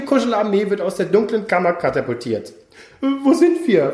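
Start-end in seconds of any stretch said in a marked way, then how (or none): 3.0-3.9 bewildered. Acted. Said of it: "fragt Ole".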